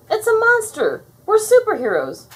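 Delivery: loud, high-pitched protest; indignant yelling. A narrator's reading. Buttercup insisting superheroes fight monsters, yelling. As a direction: argue hotly, punching each phrase. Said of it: "Buttercup yelled".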